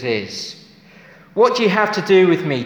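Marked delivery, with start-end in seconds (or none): 1.3-2.6 fast, higher, louder quoted shout; demon's cry. A narrator's reading. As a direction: fire the demon's challenge out quickly and loud. Quoting the demon's words again.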